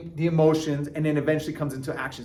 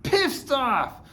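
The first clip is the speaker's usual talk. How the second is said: a performed mad complaint, much higher; mock angry outburst; 5 semitones higher, much slower than usual. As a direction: snap at your partner, hammed-up. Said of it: "this is".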